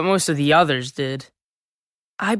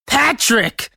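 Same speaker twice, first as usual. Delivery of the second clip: raised, exasperated shout; angry shout; 7 semitones higher than usual. Acted. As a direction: yell at the brother. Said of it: "I shouted, mad now".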